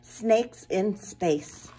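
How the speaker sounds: lively rhythmic read-aloud; rhyming storytime read. A narrator's reading. Librarian reading a rhyming book aloud to kids. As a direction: bounce the rhymes for the children. Reads rhymed picture book.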